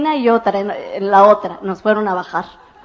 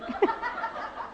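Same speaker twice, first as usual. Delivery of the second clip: high burst of laughter; 11 semitones higher, 13 dB quieter than usual. Real, not acted. Laughing at own story.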